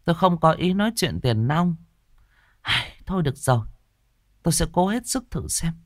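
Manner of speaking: a hint of a weary medium; sighing resignation. Medium sighs and agrees to try, weary. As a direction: give in with a sigh. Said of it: "Cô Đồng Mơ thở dài".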